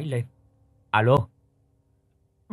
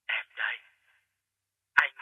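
a ghost fading down a phone line; faint echoing whisper; faint, distant voice; 2 semitones higher than usual. Acted. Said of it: "giọng nói của ông Phát nhỏ lắm, nghe cứ loáng thoáng, âm vang".

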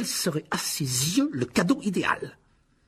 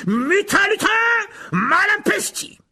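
high, much louder, slow incantation; shouted spell; 8 dB louder, slower than usual. A narrator's reading. Lockhart casting at the pixies, shouted spell. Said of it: "brandit sa baguette magique et cria".

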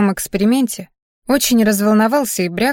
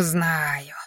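a lower, much softer second "знаю", dropped away under the breath; old man brushing it off, grumbling "знаю" into nothing; mild grumble, repeated "знаю" fading low; grumbled; 4 semitones lower, 8 dB quieter than usual. A narrator's reading. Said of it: "проворчал дедуля".

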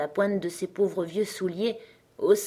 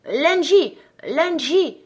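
loud, high call from the stairs; insistent singsong shout, falling at the end; 9 semitones higher, 7 dB louder, much slower than usual. A narrator's reading. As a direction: call the child's name up the stairwell. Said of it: "cria une voix dans l'escalier".

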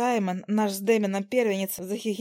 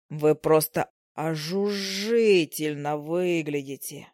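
low slow gallant fly; 5 semitones lower, much slower than usual. Acted. Male fly gallantly courts female fly.

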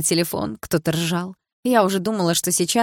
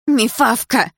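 muffled, loud insult through a hair tie; hair tie in mouth; 6 semitones higher, 6 dB louder, much slower than usual. A narrator's reading. Dilara cursing Milana with a hair tie between her teeth. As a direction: spit the word through a mouthful. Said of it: "взяв резинку в рот".

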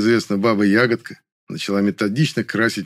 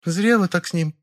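sighing; 9 semitones higher than usual. A narrator's reading. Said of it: "вздохнула Лапина".

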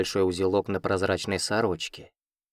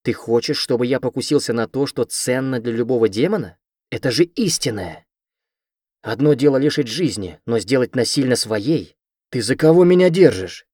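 rising loud indignation, higher at the end; a man refusing his sister, furious shout; outraged; 4 semitones higher, 7 dB louder than usual. Acted.

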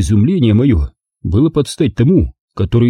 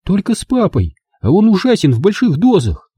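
put-on high, sulky girl's voice; pouting Angela; 9 semitones higher than usual. A narrator's reading. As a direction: pout it out. (Анжела обиженно, надув губы)

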